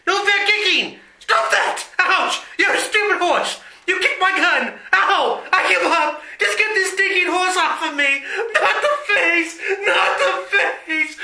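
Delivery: high, frantic cries under attack; villain begs the horse to stop; panicked pleading. Acted. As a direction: beg as the horse kicks you.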